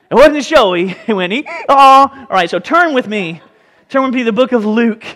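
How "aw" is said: a high, loud, hammed-up groan of letdown; playful loud aw; mock disappointed aw. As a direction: sigh like a kid who got a donkey instead of a horse. Mock disappointment at humble donkey.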